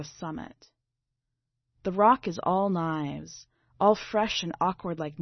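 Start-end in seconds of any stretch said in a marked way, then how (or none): none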